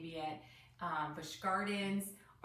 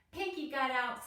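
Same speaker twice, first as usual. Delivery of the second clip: excited call; 6 semitones higher, 4 dB louder than usual. A narrator's reading. Girl Penny alarmed.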